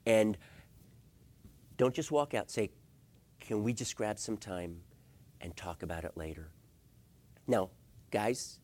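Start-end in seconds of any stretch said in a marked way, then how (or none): 3.4-6.5 low, soft, conciliatory ask; calm request. Acted. Spouse asking to talk about it later, calmly. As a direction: ease the tension with a gentle request.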